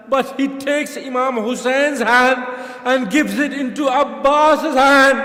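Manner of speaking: slow, loud, building lament; preaching at full force. Acted. Preacher building a mourning climax, slow and loud. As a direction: drive the grief home to the mourners.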